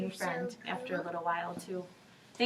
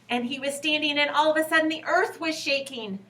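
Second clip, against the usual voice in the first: higher, louder as the earth shakes; excited rising drama; 6 semitones higher, 7 dB louder than usual. A narrator's reading. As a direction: build it up big.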